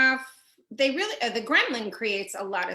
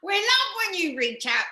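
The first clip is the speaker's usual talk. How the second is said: mock Australian accent; 7 semitones higher, 4 dB louder than usual. Host greeting Australian viewers live, playful Australian accent. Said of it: "That sounded Australian".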